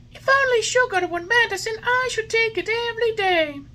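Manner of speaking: put-on high, loud whiny boy voice; stubborn puppet. A narrator's reading. Puppet refusing the fairy's medicine. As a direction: refuse like a spoilt child.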